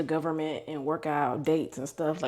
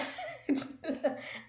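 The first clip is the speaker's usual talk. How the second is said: high soft teary laugh; breathy embarrassed chuckle; laughing through tears; 10 semitones higher, 9 dB quieter than usual. Real, not acted. Laughs after boss quote.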